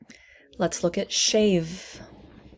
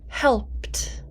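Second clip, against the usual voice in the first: whispered word; 4 semitones higher than usual. Shown as a demonstration. Teacher whispering a word for students. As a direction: whisper Helped a little higher. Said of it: "please repeat after me".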